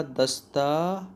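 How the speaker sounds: "दस्तावेज" stretched syllable by syllable; slow spelling-out. Shown as a demonstration. Teacher writes it for students, "दस्तावेज" slow and drawn out. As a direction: draw out "दस्तावेज" very slowly, lingering on "taa". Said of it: "कैसे लिखा जाएगा".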